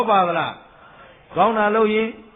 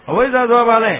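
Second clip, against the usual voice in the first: louder, higher pressing question; insistent repeated question; 4 semitones higher, 7 dB louder than usual. Real, not acted. Monk quizzing the congregation on ignorance, loud and insistent. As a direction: press the listeners with a raised voice.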